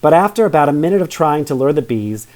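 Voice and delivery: usual speaking voice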